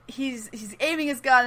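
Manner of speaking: usual speaking voice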